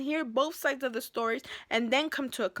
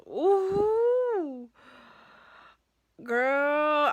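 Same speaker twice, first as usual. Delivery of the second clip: high, slow, stretched ooh; exasperated ooh; 5 semitones higher, 6 dB louder than usual. Real, not acted. To viewers about the boss, a drawn-out high ooh.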